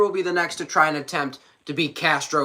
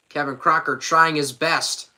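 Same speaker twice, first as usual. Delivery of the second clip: usual speaking voice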